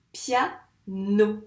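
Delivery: piano split as pia - no; syllable by syllable. Shown as a demonstration. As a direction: say piano in two detached syllables. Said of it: "combien de syllabes". (piano découpé en pia - no)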